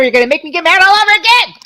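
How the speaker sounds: flaring up in real irritation, rushed; getting mad again. Real, not acted. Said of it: "I just got pissed".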